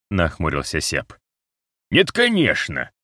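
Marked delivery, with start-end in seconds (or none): 1.9-2.9 slow, high eye-rolling sarcasm; mock-obvious, exasperated retort. A narrator's reading. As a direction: drag it out as if answering a naive question. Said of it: "Розе закатил глаза, мол".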